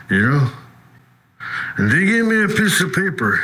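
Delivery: normal talking voice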